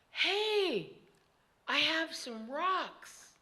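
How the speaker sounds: put-on high, slow, hushed child voice; child's idea. A narrator's reading. Girl in the basket telling the kids, high slow voice. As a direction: offer the idea slowly, eager.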